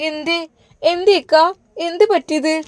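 alarmed aunt asking what's wrong; aunt anxiously asking what happened. Acted. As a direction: ask anxiously, voice lifting high.

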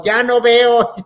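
high, loud retold cry; hammed-up shriek of blindness; panicked screaming. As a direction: yelp it in mock panic. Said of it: "y yo gritaba".

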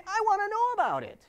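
loud, high insistence on know; emphatic. Real, not acted. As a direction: hammer home that you want to know. Emphatic plea to users.

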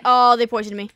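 loud flustered gamer panic; flustered and frantic; panicking gamer. Real, not acted. Getting raided in game.

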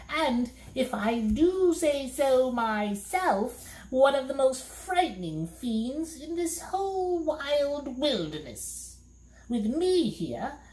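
high, slow gloating fiend; a high put-on monster brag; boasting monster. A narrator's reading. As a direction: boast like a fearsome beast. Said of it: "he replied".

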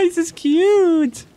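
high, slow cooing; high slow baby-talk coo; cutesy baby talk. Acted. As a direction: gush over the kitten. Cooing at cats.

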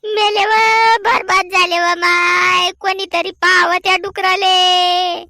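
keen and sob like the whole village should hear; mock-tragic howling of a robbed housewife; wailing lament. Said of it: "काहून रडा लागली शांताबाई?".

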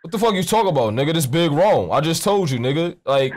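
usual speaking voice

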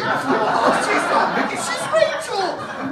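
high, loud, breaking into laughter; can barely get it out for laughing. Real, not acted. Punchline laughing at dad's mistake.

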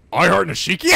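ordinary talk, nothing marked

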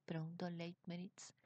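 ordinary delivery, nothing marked

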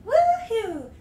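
high, loud cheer; high-pitched woohoo; excited whoop. Real, not acted. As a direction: let out a bright celebratory whoop. Cheering during stream plugs.

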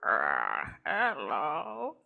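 very low croaking hello; deep, throaty frog demo. Shown as a demonstration. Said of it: "how I would sound if I was a frog".

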